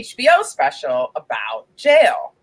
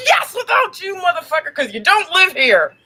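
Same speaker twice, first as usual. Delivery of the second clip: opens with a higher, shouted "Yes, without you"; mock shout; 6 semitones higher than usual. Host retorting to a guy texting, mock shout. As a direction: yell it right back at him.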